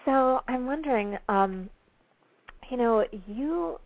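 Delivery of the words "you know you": usual speaking voice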